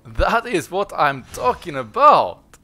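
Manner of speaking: slow, loud, savoured cheer; drawn-out victory shout. Real, not acted. Repeats it, drawn out.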